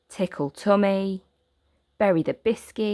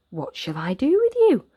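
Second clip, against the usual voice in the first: put-on a touch higher, puzzled young boy; wondering what to do with the dog; lightly voiced boy; 7 semitones higher, 4 dB louder than usual. Boy asking the lost dog, lightly puzzled. Oliver wonders aloud to Patch.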